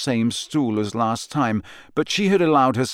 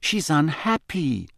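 mild exasperation; 6 semitones higher than usual. A narrator's reading.

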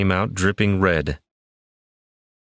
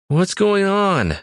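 high, slow alarmed demand; 13 semitones higher, slower than usual. A narrator's reading. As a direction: demand it, startled awake. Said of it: "Wendell demanded".

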